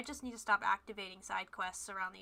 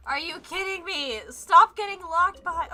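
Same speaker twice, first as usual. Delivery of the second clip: higher, much louder, fed up; frustrated yelling; 6 semitones higher, 15 dB louder than usual. Real, not acted. Streamer ranting at the locked quest, loud and frustrated. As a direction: shout it at the game. Venting at the game.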